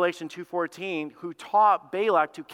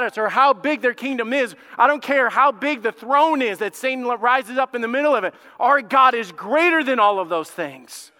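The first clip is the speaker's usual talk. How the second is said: raised, forceful sermon delivery; impassioned preaching; 7 semitones higher, 7 dB louder than usual. Real, not acted. Preacher rallying church on God's greatness, impassioned. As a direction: preach it with rising conviction.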